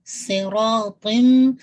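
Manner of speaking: ordinary delivery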